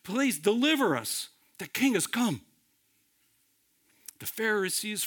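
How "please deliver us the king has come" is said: high, urgent cries, lightly shouted; crowd's pleading shout. Retold or imitated. Crowd begging the coming king for deliverance, retold pleading shout. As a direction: imitate a crowd begging for rescue. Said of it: "The crowd is shouting".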